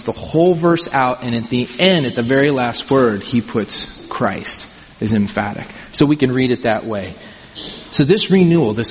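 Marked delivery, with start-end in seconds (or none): none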